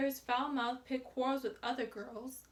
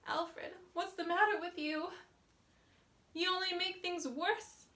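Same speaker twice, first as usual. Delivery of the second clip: put-on higher coaxing girl; 6 semitones higher than usual. A narrator's reading. Dancer coaxing angry Alfred.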